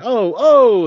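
put-on whooping at the girls going by; catcalling. The gang catcalling girls who walk by, loud high whoop.

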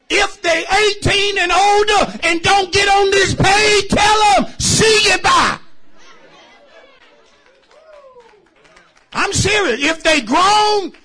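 loud, drawn-out preaching cadence; full-force preaching. Acted. Preacher pressing the congregation to give thanks, loud and drawn out.

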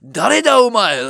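loud, high mock-furious Japanese; hammed-up aggressive demand; imitated angry shouting. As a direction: bellow like an enraged Japanese man. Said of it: "like, really angry shit".

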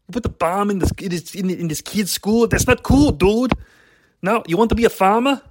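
put-on Austrian action hero accent; lightly voiced Schwarzenegger accent; Arnold Schwarzenegger impression. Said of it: "I had to do some Schwarzenegger".

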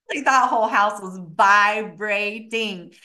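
ordinary talk

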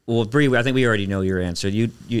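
ordinary talk

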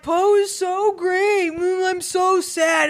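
mock the sad Poe fans lightly; mocking emo fan. Mocking Poe's emo fans to friends, lightly whiny. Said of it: "You know, like".

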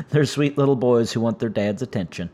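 ordinary talk

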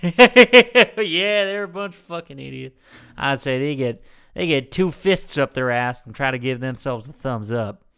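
hammed-up coworker impression; Jimmy. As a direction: burst out scornfully, then settle into a grumble. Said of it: "accent I can do is my coworker".

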